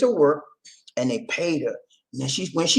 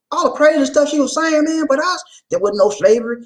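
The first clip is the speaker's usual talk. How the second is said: mock quote; 9 semitones higher than usual. Mocking her claims in high voice.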